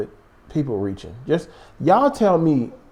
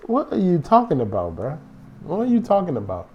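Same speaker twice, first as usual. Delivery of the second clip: ordinary talk, nothing marked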